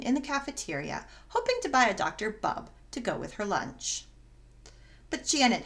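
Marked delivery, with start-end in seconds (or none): none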